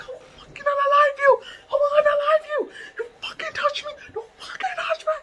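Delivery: very high, strained screech; retold furious screaming. Reenacting threats behind Lotion, high furious screech. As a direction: screech threats as if held back.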